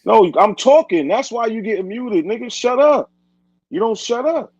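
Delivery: really snapping at him to shut up; angry outburst. Real, not acted. Host losing patience with a guest who keeps interrupting, angry shouting.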